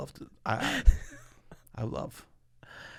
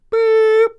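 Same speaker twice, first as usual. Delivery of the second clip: high, loud horn beep; imitated car horn; 15 dB louder than usual. Shown as a demonstration. Driver doing impression of the Kia Soul, high beep. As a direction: mimic a cute car horn at full volume. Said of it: "This is my impression of the Kia Soul".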